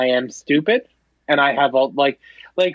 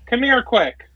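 raised voice calling across the house; 7 semitones higher, slower than usual. Real, not acted. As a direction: call her in, high and bright. Calling wife from next room.

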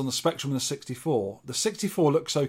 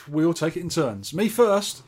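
usual speaking voice

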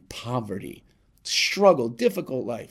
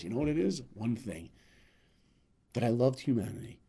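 quiet, heartfelt; 8 dB quieter than usual. Retold or imitated. George's quiet heartfelt answer.

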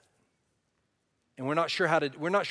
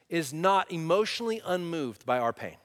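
ordinary talk

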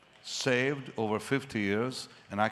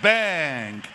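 emphatic exclamation; 2 semitones higher, 8 dB louder than usual. Real, not acted. Impact of the news.

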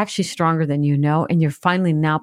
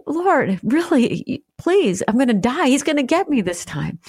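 much higher, urgent begging prayer; devastated plea; 9 semitones higher than usual. Retold or imitated. Retelling her breakdown, she begs the Lord in a high devastated plea. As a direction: plead for your life, voice pitched up in anguish. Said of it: "I mean, I was devastated".

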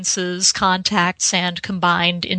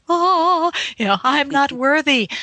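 mock humble fan; 6 semitones higher than usual. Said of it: "you've got that".

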